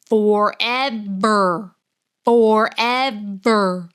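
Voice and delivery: forever, forever drawn out very slowly; dragged out. Real, not acted. Reader complaining about the long exposition, slow dragged-out words. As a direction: stretch each word to show the boredom.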